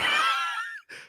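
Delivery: sudden airy laugh; high burst of laughter. Real, not acted. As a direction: break into a high giggle. Laughs at the funny name.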